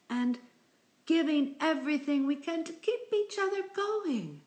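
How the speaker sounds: high, loud, sugary chain-letter voice; mock the sappy chain letter in a high sing-song. Mocking a sentimental chain email, high and loud. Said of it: "someone sends you something and says".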